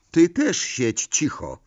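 a huffy mother hushing her son; huffy scolding. A narrator's reading. Mrs Weasley to her son at breakfast, huffy scolding. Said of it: "fuknęła pani Weasley".

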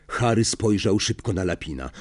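usual speaking voice

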